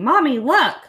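put-on high, loud excited kid yell; little girl yelling for mom's attention, loud and high; child screaming. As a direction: shout it like an impatient five-year-old. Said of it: "jumping around screaming".

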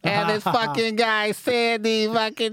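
slow, drawn-out imitation of a tattletale; hammed-up slow informant; mock complainer voice. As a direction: tattle slowly in a put-on voice. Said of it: "you doing your fucking voice out there".

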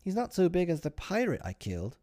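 put-on high little-boy letdown; disappointed. A narrator's reading. Michael looking at his sleeping father, disappointed. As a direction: frankly let down, in a small child's high voice. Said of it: "with such a frank disappointment".

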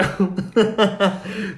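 high cackling witch laugh; witch cackle. Retold or imitated. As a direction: let out a long witch cackle. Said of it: "so she laughed like that".

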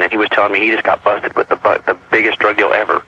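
usual speaking voice

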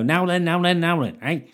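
mock comic telling gags on Question Time; cheesy club comic. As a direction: ham it up like an old working-men's club comedian. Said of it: "walk out there and go".

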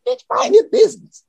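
sharp, high retort; high snap. Retold or imitated. Man barricaded in room snapping high at the grandmother. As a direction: fire it back way up high.

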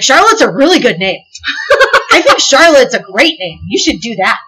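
eager laughing recommendation; laughing urging. Retold or imitated. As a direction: nudge them toward the name through laughter.

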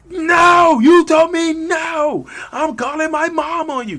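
shrieking high, very loud tantrum. Acted. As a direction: throw a childish screaming fit. Acted tantrum over hearing no.

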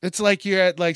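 usual speaking voice

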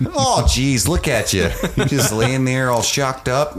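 lightly hammed Minnesota good cop; Minnesota accent. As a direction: coo it like a mock Minnesotan. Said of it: "why he's from Minnesota now".

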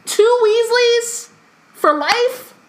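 very high, incredulous glee; mock excited Umbridge. Host imagines Umbridge banning two Weasleys, hammed-up excitement. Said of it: "she's so excited".

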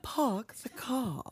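slow, quiet Boston drawl with no r in car; Park the car said slowly as pahk the cah; Boston accent. Shown as a demonstration. Friend echoes the Boston phrase, a slow, soft Boston accent. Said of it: "Wrong kind of A".

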